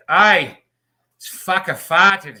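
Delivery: loud mock Australian accent, slow on the last words; a loud, slow, amused impression of an Australian man's accent. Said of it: "This fucking Australian guy behind me goes".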